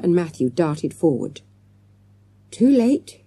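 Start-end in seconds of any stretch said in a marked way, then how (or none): none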